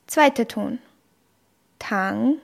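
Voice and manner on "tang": Tang rising from the middle; second tone. Shown as a demonstration. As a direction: let Tang rise like a question. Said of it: "steigt die Stimme". (Tang im zweiten Ton, steigend)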